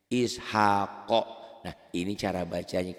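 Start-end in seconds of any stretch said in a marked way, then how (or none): 0.1-1.2 Ishaqo slow, with the final q bounced; qalqalah reading. Shown as a demonstration. Teacher shows the way it is read, bouncing qalqalah. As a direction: draw out "Ishaqo" and echo the q at the end. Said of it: "yang ini cara bacanya".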